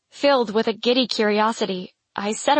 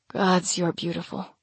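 hushed ardent; 4 semitones lower, 5 dB quieter than usual. A narrator's reading. Vale ardent, almost pained.

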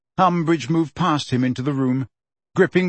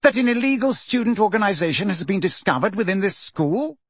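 high, indignant accusation; contained anger rising into a question; thick with anger; 7 semitones higher than usual. A narrator's reading. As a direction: press the charge angrily, pitched up. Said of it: "his voice now thick with anger".

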